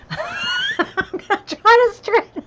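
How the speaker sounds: high squealing laugh through words. Real, not acted. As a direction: laugh it out, slowly. Laughs at her struggle.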